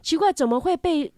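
ordinary talk

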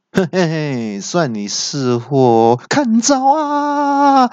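chuckle, then high drawn-out attack call; gloating villain. A narrator's reading. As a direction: go from slow gloating to a high battle cry. 恶怪得意出招. (得意冷笑，再拉高喊招)

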